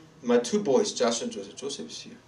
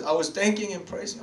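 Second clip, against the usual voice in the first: ordinary delivery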